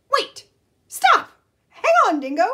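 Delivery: high, loud cries of stop; urgent shout. Acted. Animals yelling at Dingo to stop, high shouts. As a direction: yell to stop him in time.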